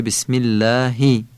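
"اللهی" with thin long "ææ", then short "i"; Arabic kasra. Shown as a demonstration. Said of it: "کسره ها مثل ای ولی کوتاه". (کسرهٔ عربی «اللهی»، مثل ایِ کوتاه)

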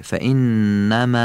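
فإنما with a long, light final alef; drawn-out vowel. Shown as a demonstration. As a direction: draw out the light "a" ending of فإنما, slowly. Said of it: "ایه کشیده باشه".

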